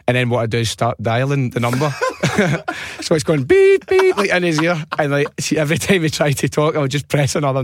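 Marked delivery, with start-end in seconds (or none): 3.4-4.2 high, loud keypad beep; imitated phone beeps. Prank caller dials while connected, high imitated beeps. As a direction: mimic button tones in his ear.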